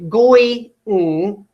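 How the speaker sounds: "goi-ng" cut as GOY, then a bare NG; wrong syllable split. Shown as a demonstration. Teacher showing a split that can't work, "goi-ng" as GOY plus NG. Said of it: "split it here and say".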